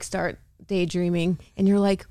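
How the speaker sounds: normal talking voice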